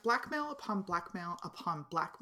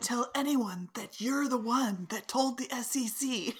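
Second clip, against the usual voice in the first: raised, projecting voice imitating an indiscreet talker; pitched-up, hammed-up public declaration; mock loud talk; 5 semitones higher than usual. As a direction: announce a secret far too openly. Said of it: "Really loudly".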